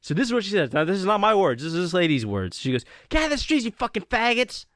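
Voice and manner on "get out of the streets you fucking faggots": high-pitched mock yell; heated, raised-voice insult; angry driver. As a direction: imitate an irate driver hurling abuse. Imitates angry driver hurling an insult.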